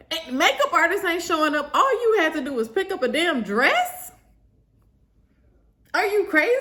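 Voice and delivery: high, indignant rant; retold exasperation. Stressed bride berating the bridesmaid over a dress, exasperated. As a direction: re-enact berating her, pitch climbing to disbelief.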